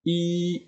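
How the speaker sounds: "I" held long on a high level pitch; first tone. Shown as a demonstration. Teacher showing the original tone of one, "I" held high and level. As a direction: sustain "I" high and even, Mandarin first tone. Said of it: "Nada asli dari i adalah nada pertama".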